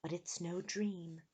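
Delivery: soft, slow reply; mysterious voice. A narrator's reading. Hidden girl answers Eric, soft mysterious voice. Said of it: "said a voice".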